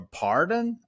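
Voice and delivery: high, puzzled seller asking to repeat; confused seller. Retold or imitated. Ray mimics a confused seller on the phone, high and puzzled. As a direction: ask like a seller who couldn't follow you. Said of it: "the most response I get from the seller".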